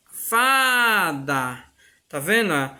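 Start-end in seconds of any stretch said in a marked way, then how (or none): 0.1-1.6 fada with a long, held "fa"; stressed first syllable. Shown as a demonstration. Teacher showing pupils the tonic syllable, fada with drawn-out "fa". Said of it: "o fá eu falo com mais intensidade".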